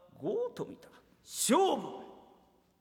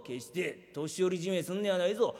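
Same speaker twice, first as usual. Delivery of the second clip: usual speaking voice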